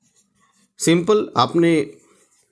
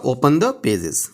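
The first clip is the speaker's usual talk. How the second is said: slow model phrase; 6 semitones lower, much slower than usual. Shown as a demonstration. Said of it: "teacher models English phrase".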